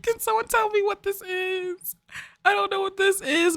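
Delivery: high, soft squeaky plea; baffled squeal. Real, not acted. Player begging someone to explain the sight, high baffled squeal.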